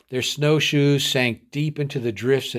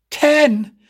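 much higher, shocked and louder; dismayed echo; 6 dB louder than usual. A narrator's reading. Weary boy hearing ten more miles, dismayed echo. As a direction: throw the word back in disbelief. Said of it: "echoed Harry in dismay".

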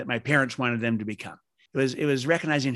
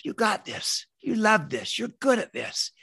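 higher-pitched, lightly voiced supportive husband; encouraging husband; 7 semitones higher than usual. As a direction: cheer them on as a husband would, pitched up. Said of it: "just like your husband".

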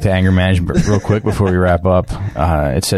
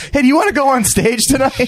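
very high, put-on voice; hammed-up high-pitched offer; acted invitation. Acted quote offering stage time.

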